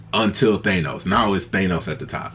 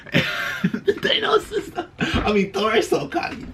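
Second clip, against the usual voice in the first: real laughter; slower than usual. Real, not acted. Cracking up over Thor.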